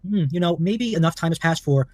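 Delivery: ordinary delivery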